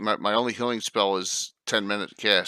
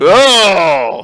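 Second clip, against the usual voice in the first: sudden loud joyful gasp; excited shout; 10 semitones higher, 17 dB louder than usual. Real, not acted. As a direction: burst out with delight. A big cheer after a roll.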